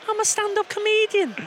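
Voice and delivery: high Geordie comedian voice; lightly voiced Geordie comedian; Sarah Millican impression. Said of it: "And then I turn into Sarah Millican".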